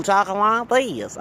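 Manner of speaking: put-on high, drawn-out Anglo woman accent. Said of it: "talking like an Anglo woman".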